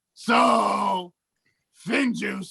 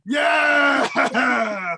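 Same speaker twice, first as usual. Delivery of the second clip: excited shout; 3 semitones higher, 4 dB louder than usual. Real, not acted. Cheering a shared pick.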